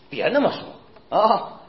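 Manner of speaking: drawn-out slow rebuke; slow warning. Real, not acted. Preacher slowly warns the congregation not to talk that way. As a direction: stretch each word to warn them.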